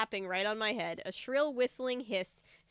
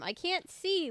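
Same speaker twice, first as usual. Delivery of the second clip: shout; 8 semitones higher than usual. A narrator's reading. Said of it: "Luke shouted".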